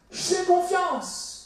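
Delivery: high, drawn-out burst of trust; confident declaration. Acted. The man telling Jesus he trusts him, confidently. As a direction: proclaim it before any doubt sets in. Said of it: "Et là, sa conscience le rattrape".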